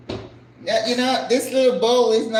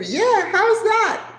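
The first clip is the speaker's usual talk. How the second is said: proud; 6 dB louder, slower than usual. Real, not acted. Showing finished bowl.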